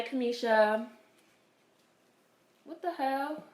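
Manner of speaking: ordinary delivery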